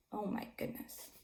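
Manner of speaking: soft whispered gasp; whispered aside. Acted. Narrator whispering to children about angry goat. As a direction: breathe it like a shocked aside.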